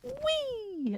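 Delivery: high, long gliding "wee"; light, drawn-out high squeal; playful wee of flight. Acted. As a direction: squeal it as if flying off. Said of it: "suddenly going".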